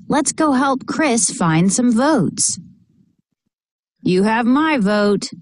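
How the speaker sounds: ordinary delivery, nothing marked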